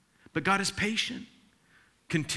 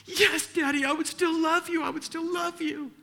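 put-on much higher, small child's voice; little girl voice; 14 semitones higher than usual. Young daughter promising dad her love, high and sweet. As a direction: answer sweetly as a four-year-old. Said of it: "she said".

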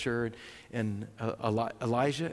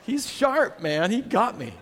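much higher burst of admiration; amused, high praise; delighted; 8 semitones higher, slower than usual. Real, not acted. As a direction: marvel at being outwitted. Delighted at being fooled.

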